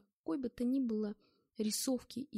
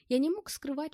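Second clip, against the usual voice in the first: ordinary delivery, nothing marked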